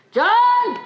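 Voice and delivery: sharp loud call across the room. Acted. Master calling his servant, a loud call. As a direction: call John in, loud and high.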